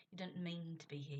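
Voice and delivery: usual speaking voice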